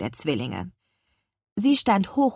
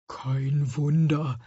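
slow, droning beetle grumble; drawn-out insect grumble; grumbling beetle; 2 semitones lower, much slower than usual. A narrator's reading. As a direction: hum it out sluggishly like a beetle. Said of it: "brummte der Käfer".

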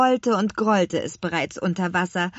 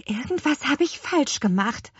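higher-pitched, puzzled admission; perplexed; 9 semitones higher than usual. A narrator's reading. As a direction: wonder helplessly what went wrong. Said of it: "ratlos sah Petronella". (ratlos, mit höherer Stimme)

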